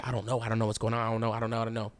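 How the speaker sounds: low, run-on repeating with little tune; dazed repetition. Retold or imitated. Storyteller tells the kids he is lost, dazed repetition. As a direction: repeat it over and over in a low monotone. Retelling his confusion to the kids.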